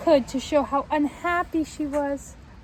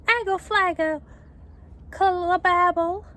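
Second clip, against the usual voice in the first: put-on baby babble; 6 semitones higher, 5 dB louder than usual. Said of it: "Trixie turned to her daddy and said".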